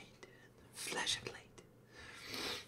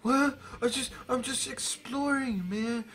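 put-on higher, innocent kid voice; imitating younger self; 8 semitones higher than usual. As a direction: play the clueless kid caught out. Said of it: "I'm like".

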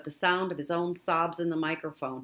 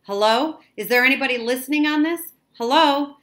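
raised, urgent call into a microphone; higher, louder, questioning radio call; 8 semitones higher, 10 dB louder than usual. A narrator's reading. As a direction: call high and loud to see who's listening. Boy calls into dead radio.

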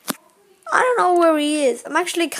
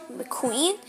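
usual speaking voice

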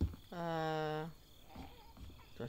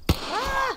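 sudden high scream of fright; panicked scream; 12 dB louder than usual. Real, not acted. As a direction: shriek in real panic. Zombie swarm attacks.

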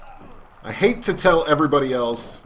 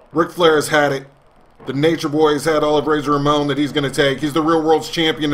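hyped ringside play-by-play call; wrestling announcer; 4 dB louder than usual. Acted. Gamer calling his own match, announcer style.

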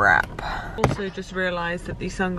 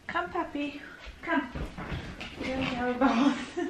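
calling a dog; 5 semitones higher, 8 dB quieter than usual. Real, not acted.

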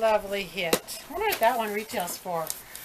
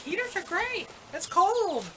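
high mock voice; 10 semitones higher than usual. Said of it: "I'll say".

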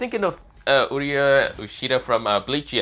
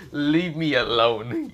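slow, higher, drawn-out exasperation; exasperated plea; 4 semitones higher, much slower than usual. Acted. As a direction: drag it out, fed up with being bothered. Character begs an unwanted pursuer off.

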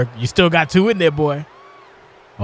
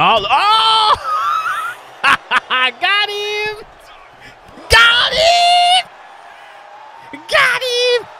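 excited cheering shout; much slower than usual. Real, not acted. Streamer cheering a move in the match, high excited yells. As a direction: celebrate the pin attempt like a fan.

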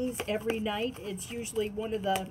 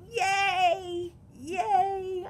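excited yay; 8 semitones higher than usual. Real, not acted. Cheering the new deck.

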